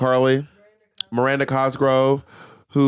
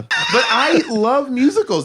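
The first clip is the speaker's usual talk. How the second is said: slow, high, sing-song I love musicals; high drawn-out confession; 9 semitones higher, slower than usual. Real, not acted. Admitting to friend she loves musicals, sing-song. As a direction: stretch it out in a lilting voice.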